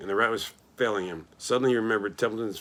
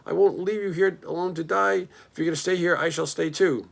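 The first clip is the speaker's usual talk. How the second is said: desperate; 7 semitones higher, 3 dB louder than usual. A narrator's reading. Pig refuses to leave dying friend.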